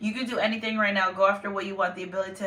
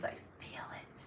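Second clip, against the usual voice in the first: whisper; 19 dB quieter than usual. Real, not acted. Confiding about her heightened feelings.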